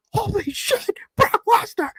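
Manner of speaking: high squealed astonishment; hammed-up fan freak-out, pitch shooting up; re-enacted shock. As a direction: gasp it out in falsetto disbelief. Re-enacting shock at a surprise return.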